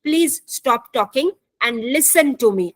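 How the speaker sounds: slow, deliberate example of a firm request; slow teacher demo of a polite command; slow model sentence. Shown as a demonstration. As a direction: spell it out slowly for the class. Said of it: "मैं आपसे कहूंगी".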